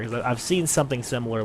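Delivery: normal talking voice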